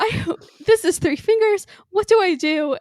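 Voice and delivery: high, loud, fast mock panic. Donna panicking over the three-finger scanner, high and frantic. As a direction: squeal it out like she's flustered and lost. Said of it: "panicking about the three-finger scanner".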